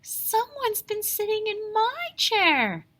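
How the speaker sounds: a startled mother bear; Mama Bear gasping. A narrator's reading. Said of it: "gasped Mama Bear".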